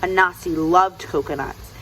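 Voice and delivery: ordinary delivery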